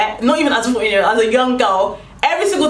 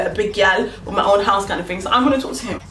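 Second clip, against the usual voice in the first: ordinary delivery, nothing marked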